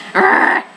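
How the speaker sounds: a sudden high loud growl of frustration; frustrated groan. Real, not acted. Player fed up with a smug character, frustrated arrgh. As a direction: let out a loud annoyed arrgh.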